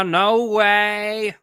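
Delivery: mock Hillary code switch. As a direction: overdo a fake down-home accent. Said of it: "worst code switch of all time".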